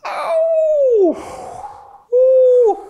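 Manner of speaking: shouted high call ringing in an empty room; echo. Shown as a demonstration. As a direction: call out loud to test the echo. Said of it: "Здесь даже эхо".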